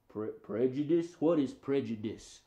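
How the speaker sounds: low, halting dog voice; puzzled puppet dog. Pretend. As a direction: stumble over an unfamiliar word. Puppet dog puzzled by new word.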